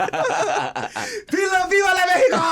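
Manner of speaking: high loud shouted "viva", laughing; a triumphant rally yell; patriotic cry. Retold or imitated. Ripping off his pants to reveal the flag, triumphant shout.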